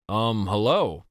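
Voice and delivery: usual speaking voice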